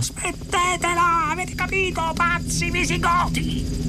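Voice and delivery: very high, strained outcry; furious yelling. A narrator's reading. Arthur runs at the demolition crew, furious yelling. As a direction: scream abuse at them, stretching the words. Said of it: "urlò".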